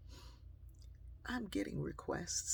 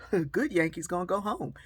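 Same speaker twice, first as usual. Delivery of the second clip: teasing punchline; 3 semitones higher, 9 dB louder than usual. Retold or imitated.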